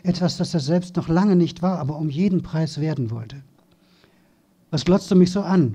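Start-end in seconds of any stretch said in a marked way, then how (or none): 4.7-5.7 put-on snapped, louder, at the boy on the ground; a bully's snarling challenge; angry hiss. A narrator's reading. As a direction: spit the question out at him. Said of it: "fauchte Matthew".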